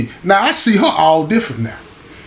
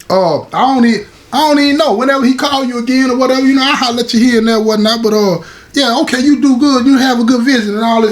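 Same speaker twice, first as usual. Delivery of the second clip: higher, fast nervous babble; flustered stammering; 6 semitones higher than usual. Retold or imitated. Caught off guard, he babbles fast and nervously at the guy's mother. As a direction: stumble over the words, trying to get rid of her. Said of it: "I get to stuttering".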